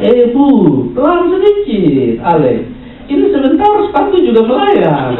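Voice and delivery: put-on high, comic scolding in Kupang-style accent; regional scolding accent. Local warning a woman a shoe will fly, mock regional scolding accent. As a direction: mimic an indignant eastern Indonesian local, regional accent. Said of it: "Orang Kuba bilang".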